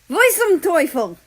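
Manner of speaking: loud, high German outburst; German exclamation. Acted. Germany in handcuffs shouting at the police, loud German. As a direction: bark it out in German, shocked. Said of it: "That roughly translates to".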